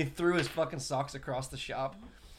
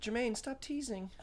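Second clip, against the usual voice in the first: high, soft, slow mock pleading; whiny falsetto pop-star imitation; 9 semitones higher, 8 dB quieter, slower than usual. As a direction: plead softly in a put-on high voice. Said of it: "Fucking with his soft voice".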